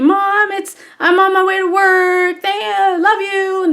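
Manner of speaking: high, sing-song text message voice; imitated son. Son texting mom on way to work, high and sing-song. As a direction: chirp it lightly at a held high pitch.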